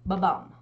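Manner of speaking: da-DUM heartbeat rhythm; iamb beat ba-bum. Shown as a demonstration. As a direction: tap out Ba-bum like a heartbeat, weight on bum. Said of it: "followed by a long and accented syllable".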